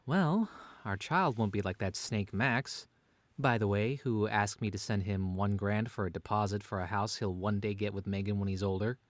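ordinary delivery

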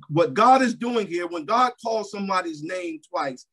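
ordinary talk, nothing marked

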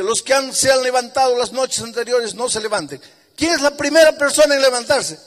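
high, loud preaching build-up. Acted. Pastor telling the congregation about the nights, full-force preaching. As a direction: drive each no harder at full force.

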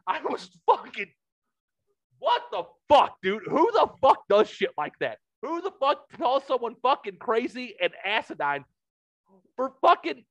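exasperated rant. Real, not acted. Ranting to the co-host about the rude friend, exasperated. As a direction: let the outrage spill out.

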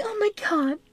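very high-pitched imitation of women; exaggerated falsetto parody; mocking women's voice. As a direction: put on a high, gushy mock-female voice. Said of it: "Women are gonna look at it like".

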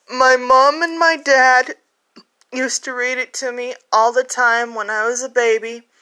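ordinary delivery

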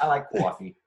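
kwawfee through a laugh, pitched high; New York accent, laughing. Retold or imitated. Laughing as he shows his New York coffee, in a hammed-up accent. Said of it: "Those were the two that I would sometimes do".

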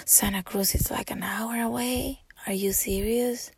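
breathless whispered amazement; awed whisper. Retold or imitated. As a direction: whisper it in disbelief. Retold awed gasp, whispered.

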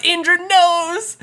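high, loud, drawn-out exclamation; all-caps shout. Retold or imitated. Host reading her all-caps note about Indra, shouted excitedly. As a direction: belt it out slowly like all caps. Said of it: "I wrote in all caps".

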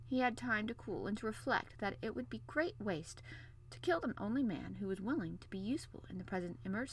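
usual speaking voice

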